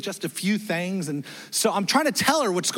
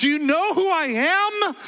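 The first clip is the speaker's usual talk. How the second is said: entitled outburst; 10 semitones higher than usual. Retold or imitated. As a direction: puff up and demand respect. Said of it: "share our authority".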